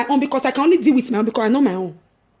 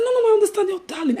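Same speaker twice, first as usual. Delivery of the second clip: fast reassurance; 7 semitones higher, faster than usual. Retold or imitated. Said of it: "I said".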